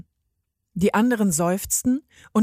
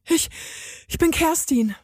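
out of breath; 8 semitones higher than usual. A narrator's reading. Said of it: "völlig außer Puste".

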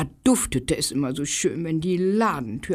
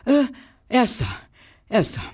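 panting; 5 semitones higher, faster than usual. Acted. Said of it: "hechelte er".

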